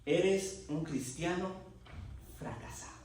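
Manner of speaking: sentencia lenta, con pausa antes de la última palabra; voz clara y sentenciosa. Retold or imitated. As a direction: pronuncia despacio el veredicto y deja caer el final. (dicho lenta y claramente)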